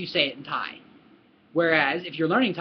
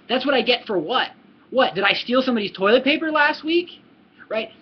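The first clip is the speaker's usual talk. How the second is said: mock indignant, higher-pitched questioning; incredulous retort; 5 semitones higher, 4 dB louder than usual. Answering blame over missing toilet paper, incredulous. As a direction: challenge it in disbelief, voice climbing. Acted retort to "serves you right.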